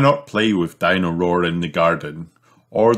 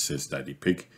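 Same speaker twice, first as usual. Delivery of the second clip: usual speaking voice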